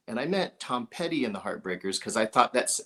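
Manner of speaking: ordinary talk, nothing marked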